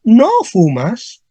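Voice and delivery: ¿no fumas? drawn out slowly, rising at the end; slow rising question. Shown as a demonstration. Teacher drawing out ¿no fumas? as a slow rising question. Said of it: "si te pregunto".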